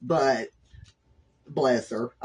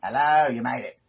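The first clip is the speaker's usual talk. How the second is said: ordinary delivery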